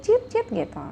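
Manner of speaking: very high squeaky chirps; mouse squeak imitation. Shown as a demonstration. Teacher imitates a little mouse for students, very high squeaks. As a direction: squeak like a tiny quick mouse. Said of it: "itu bunyinya cit".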